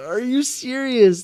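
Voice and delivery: high laughing no, then slow disbelief; shocked disbelief. Real, not acted. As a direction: gasp it out in real shock. Shocked reaction to message.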